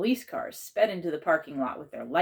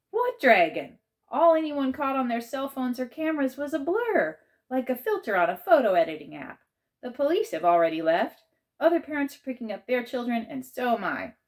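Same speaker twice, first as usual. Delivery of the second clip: dismissive aunt; 8 semitones higher, 5 dB louder than usual. A narrator's reading. Aunt Misty dismissing principal.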